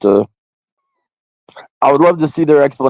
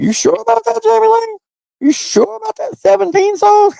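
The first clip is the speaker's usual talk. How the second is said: very high, squeaky mock doubt. Mocking the singer in a high squeaky voice. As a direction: squeak it out like a cartoon skeptic. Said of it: "I was like".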